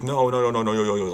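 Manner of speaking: alarmed shout. Retold or imitated. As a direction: jump in to stop the slapping. Everyone stops the slapping.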